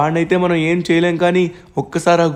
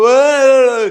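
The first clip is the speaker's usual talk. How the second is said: higher, louder, drawn-out panicked cry; panicked scream; 7 semitones higher, 7 dB louder, much slower than usual. Acted. Seized by the titan, panicked scream. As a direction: cry out in terror.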